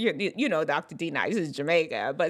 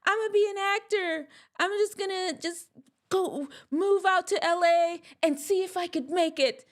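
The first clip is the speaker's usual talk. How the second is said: mock naive dreamer; 11 semitones higher than usual. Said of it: "it wasn't like he just decided today that".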